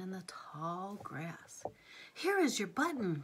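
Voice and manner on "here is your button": calling out to his friend, a bit higher and louder; a glad little cry; cried out. A narrator's reading. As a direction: Frog crying out as he spots a button. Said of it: "cried Frog".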